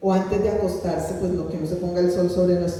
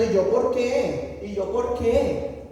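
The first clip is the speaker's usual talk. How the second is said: mock protest; slower than usual. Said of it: "hubiera seguido diciéndole al Espíritu Santo".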